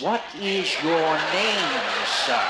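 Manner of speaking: painfully slow and emphatic; slow, exaggerated enunciation. Shown as a demonstration. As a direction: stretch every word out as if for a lip-reader. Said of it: "Read my lips".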